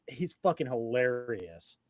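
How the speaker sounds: usual speaking voice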